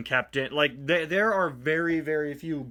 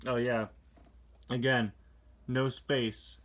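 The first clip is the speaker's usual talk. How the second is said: usual speaking voice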